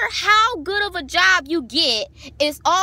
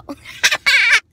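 burst of laughter; 10 semitones higher, 6 dB louder than usual. Real, not acted. Laughing at her own story.

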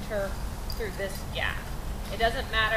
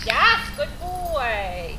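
drawn-out, louder praise for the dog; excited praise; 3 semitones higher, 6 dB louder, much slower than usual. Real, not acted. Trainer praising her dog at the weave poles, slow loud praise. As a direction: stretch it out and cheer him on.

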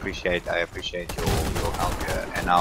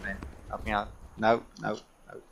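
usual speaking voice

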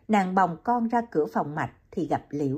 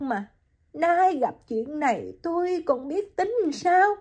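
choked with grief; 8 semitones higher than usual. Acted. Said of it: "Nàng nghẹn ngào".

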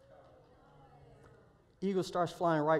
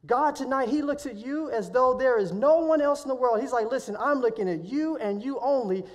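louder, higher preaching push; full-force preaching; 4 semitones higher, 5 dB louder than usual. Acted. Preacher tells the congregation God sees them, forceful preaching. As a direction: preach it to the crowd with fire.

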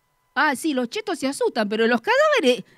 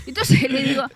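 ordinary delivery

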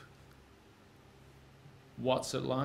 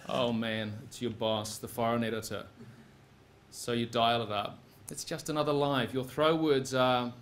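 ordinary talk

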